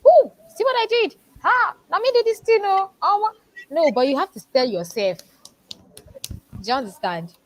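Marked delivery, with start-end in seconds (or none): 0.1-3.7 high, loud mocking brag; boastful show-off. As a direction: play the smug braggart. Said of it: "you don't necessarily need to go and tell people".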